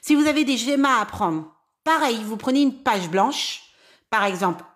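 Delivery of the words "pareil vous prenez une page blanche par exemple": ordinary talk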